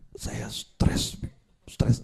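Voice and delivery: breathy whispered "Saya stres"; whispered complaint. Acted. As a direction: whisper it like a defeated guest. Menirukan tamu yang stres. (berbisik lesu "Saya stres")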